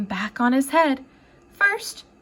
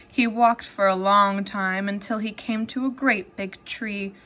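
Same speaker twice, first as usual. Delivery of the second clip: ordinary delivery, nothing marked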